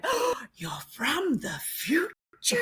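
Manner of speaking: breathy whispered awe in a light American voice; a lightly put-on American woman, amazed and hushed; American woman's voice. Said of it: "And this American voice, which I can't do".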